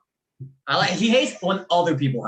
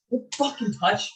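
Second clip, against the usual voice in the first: retold rebuff; 5 semitones higher, 3 dB quieter than usual. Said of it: "and he went".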